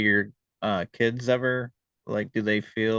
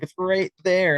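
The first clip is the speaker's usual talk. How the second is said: put-on high, urgent child's voice; insistent scared child; 9 semitones higher, 3 dB louder than usual. Daughter pointing at the cat for her mom, scared. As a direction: insist like a frightened little girl.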